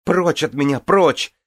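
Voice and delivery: high, loud frantic cry; breathless shout. A narrator's reading. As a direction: gasp it out, shouting. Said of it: "задыхаясь, прокричала она".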